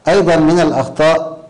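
usual speaking voice

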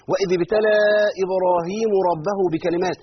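loud mock recitation error; wrong vowel demo. Shown as a demonstration. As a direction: recite the verse with إبراهيمَ and ربُّه switched. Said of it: "دي مصيبة".

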